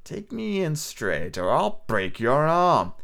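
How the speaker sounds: slow, soft, sneering threat. A narrator's reading. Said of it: "he sneered".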